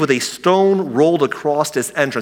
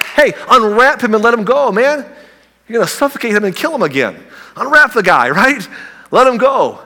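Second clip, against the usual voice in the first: higher, louder, urgent and playful; excited; 7 semitones higher, 3 dB louder than usual. Acted. Jesus telling the stunned crowd to unwrap Lazarus, excited. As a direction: hurry them, half laughing.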